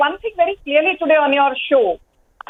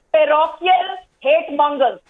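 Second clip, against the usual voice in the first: usual speaking voice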